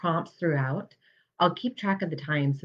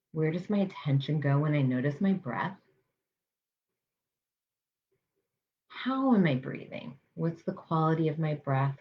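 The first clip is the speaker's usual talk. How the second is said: guided meditation. Acted. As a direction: ease listeners into calm, unhurried.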